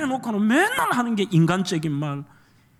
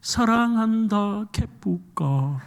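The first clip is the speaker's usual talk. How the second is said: sung slowly in Gyeongsang dialect with 개뿌까; much slower than usual. Pretend. Pastor singing the lyric in Gyeongsang dialect for laughs, slow. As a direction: drawl it like a Gyeongsang local, laughing at the end. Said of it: "경상도 말로 하면".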